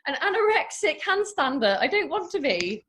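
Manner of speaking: high, loud, laughing through the words; mock the yogis while cracking up; laughing mockery. Mocking anorexic yogis, laughing high and loud. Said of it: "I'm like".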